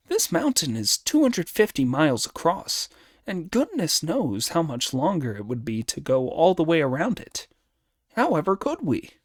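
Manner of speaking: high, animated monkey hero; Monkey, protesting. Acted. Monkey telling Sanzang there's no way round, protesting.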